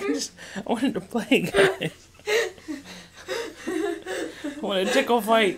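put-on high, slow childlike whine; little girl voice. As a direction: play the little daughter, pleading. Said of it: "She's like".

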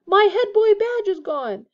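high, loud, drawn-out lament; agitated shout. A narrator's reading. Percy rounds on Harry about his badge, agitated. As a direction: wail it indignantly.

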